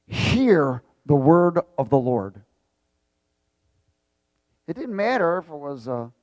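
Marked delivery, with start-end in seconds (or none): 0.1-2.4 slow, louder word of command; commanding proclamation. Retold or imitated. Preacher re-enacts the command to the bones, commanding. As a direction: draw it out as an order. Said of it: "He commanded".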